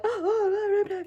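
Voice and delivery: high gasping oh's; the whole cinema gasping; audience gasps. Retold or imitated. Film fans in the cinema gasping in shock.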